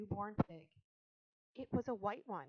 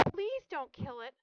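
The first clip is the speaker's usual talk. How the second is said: high, loud pleading; sobbed plea; 6 semitones higher, 8 dB louder than usual. A narrator's reading. Girl begging her father to spare the pig, mildly sobbing. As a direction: plead with a touch of a sob. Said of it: "she sobbed".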